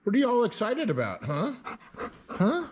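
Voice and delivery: fast, high excited questions; match the dog's excitement; high dog-talk voice. Real, not acted. Asking the excited dog what's up, fast high dog talk.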